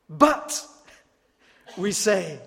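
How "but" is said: "But" hit high and loud; emphatic but. Acted. Preacher voicing believers objecting to Jesus, loud "But". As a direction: throw out "But" as a protest.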